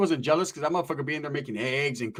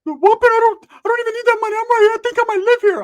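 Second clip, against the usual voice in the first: very high, loud goofy cousin voice; exaggerated high imitation of a cousin; Ricky; 10 dB louder than usual. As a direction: protest in a high-pitched squeal. Ricky answers on the phone.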